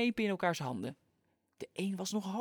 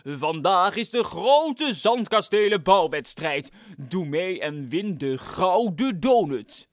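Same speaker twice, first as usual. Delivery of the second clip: loud, slow announcer voice; megaphone announcement; 10 dB louder, slower than usual. Acted. A man announces the contest to the beach, loud slow megaphone call. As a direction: boom it through a megaphone. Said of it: "riep een meneer door een megafoon".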